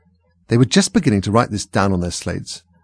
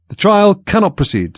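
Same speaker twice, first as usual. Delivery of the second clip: high, loud and slow pronouncement; king sternly declaring to the court, slow and loud; very grave voice; 11 semitones higher, 6 dB louder than usual. A narrator's reading. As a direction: announce it with heavy royal gravity. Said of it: "in a very grave voice".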